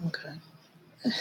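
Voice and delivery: a breathless real whisper, rising at the end; hushed, tearful okay. Real, not acted. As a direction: let okay slip out under your breath, still shaken. Composing herself after tears.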